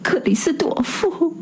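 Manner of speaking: high, breathy, voiced-down call of his friend's name; a husband reaching out in dread. A narrator's reading. Husband calls Christophe's name in a shaky whisper. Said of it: "叫了声".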